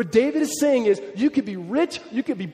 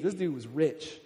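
lightly voiced Nathan, slangy and offhand; Nathan's casual retelling; 4 semitones lower, 6 dB quieter than usual. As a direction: toss it off like a modern storyteller. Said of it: "That's kinda how Nathan said it".

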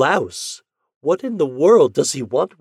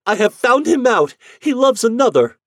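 high, loud frantic cry; wife bursting into her uncle's room; distraught; 8 semitones higher, 8 dB louder than usual. Acted. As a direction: rush it out in jealous despair. Jealous wife tells her uncle.

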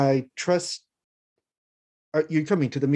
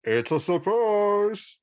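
slow, high playful tease; sing-song teasing; 6 semitones higher, much slower than usual. Acted. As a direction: draw out the surprise teasingly. GM voices Lewis at door.

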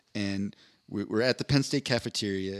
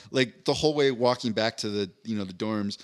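normal talking voice

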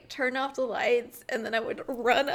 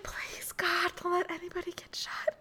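unvoiced, hushed plea; retold whispered prayer while watching; 6 semitones higher, 6 dB quieter than usual. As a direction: whisper a frantic prayer at the screen. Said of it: "I was like".

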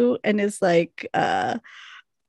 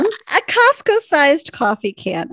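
slow, louder disbelief; mock outrage; 6 semitones higher, 5 dB louder, slower than usual. Real, not acted. Cohost mocking the coffee can, slow amused disbelief. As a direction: spell out the absurdity slowly.

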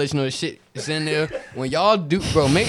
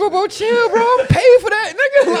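high, loud laughing bro yell; frat bro; 10 dB louder than usual. Retold or imitated. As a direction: yell at him like a hyped bro. Acting worker yelling at customer.

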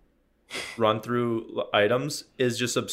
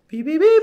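high, a bit louder squeaky beeps; card reader beep; 7 dB louder than usual. Retold or imitated. The card reader finally accepting the tap, high squeaky beeps. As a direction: chirp like a card terminal. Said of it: "and it goes".

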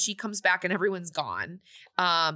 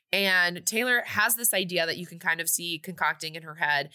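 ordinary talk

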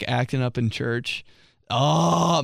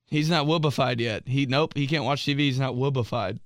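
ordinary talk

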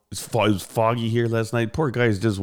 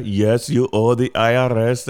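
slow, insistent mock Indian accent; put-on Indian accent, laying down a threat; 3 semitones higher, 5 dB louder, slower than usual. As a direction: imitate a pushy Indian-accented scam caller. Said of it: "with, like, an Indian accent".

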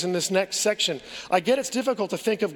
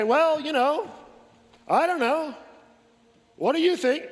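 higher, mocking don't-know voice; mock shrug; 6 semitones higher than usual. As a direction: toss the question away with a mocking shrug.

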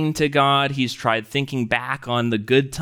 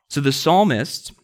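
ordinary talk, nothing marked

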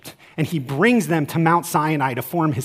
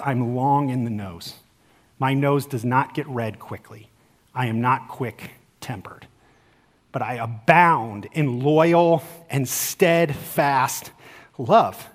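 soft, lower, tender divine voice; gentle God voice; 6 semitones lower, 4 dB quieter, slower than usual. Acted. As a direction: speak it softly as a patient father. God speaks gently about himself.

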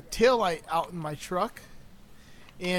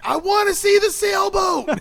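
high, loud, drawn-out quoted outburst; exaggerated shout, pitched way up; mock frustrated shout; 12 semitones higher, 8 dB louder, slower than usual. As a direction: yell it slowly like a hammed-up tantrum. Acted frustrated outburst, movie quote.

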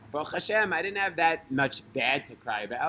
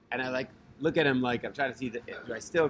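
ordinary talk, nothing marked

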